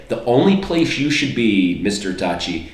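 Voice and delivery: ordinary talk, nothing marked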